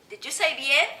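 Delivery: usual speaking voice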